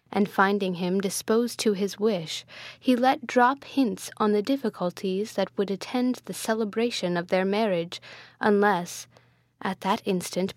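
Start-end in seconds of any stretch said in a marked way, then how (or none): none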